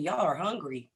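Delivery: usual speaking voice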